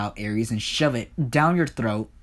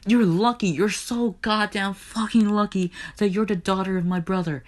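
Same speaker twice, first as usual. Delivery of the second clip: furious tirade; 9 semitones higher than usual. Acted. Hades rages at Persephone over incest, furious tirade. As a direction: tear into them with escalating threats.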